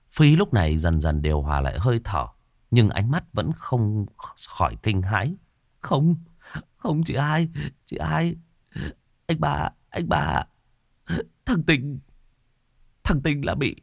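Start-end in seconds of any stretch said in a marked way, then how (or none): 5.8-13.8 terrified. Acted. Terrified brother says ghost took him.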